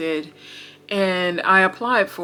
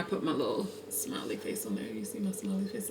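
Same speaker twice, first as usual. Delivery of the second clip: breathy little whisper to the camera; 10 semitones lower, 15 dB quieter, faster than usual. Real, not acted. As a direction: whisper it like a shared secret. Shows drink to camera, whispering.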